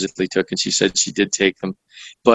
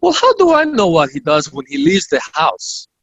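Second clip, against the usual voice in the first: imitating her perturbed comeback, pitched higher; mock-perturbed retort, raised in pitch; 5 semitones higher than usual. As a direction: play her put-out and indignant. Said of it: "in this rather perturbed voice".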